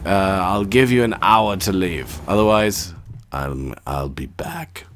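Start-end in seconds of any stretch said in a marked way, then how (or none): 3.3-4.8 put-on Austrian accent. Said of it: "It's very hard to do an Austrian accent".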